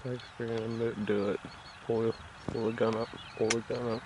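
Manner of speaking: hushed hunter's whisper; wildlife watching whisper. Real, not acted. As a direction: keep it low so the bird won't hear. Whispering while hunting a bird.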